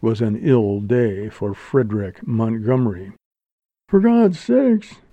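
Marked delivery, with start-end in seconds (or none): 3.9-5.0 high, slow frightened plea; high-pitched dread; breathed plea. A narrator's reading. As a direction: plead in alarm, drawn out. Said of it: "breathed a voice from the stage".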